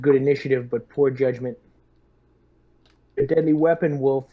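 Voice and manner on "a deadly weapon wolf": usual speaking voice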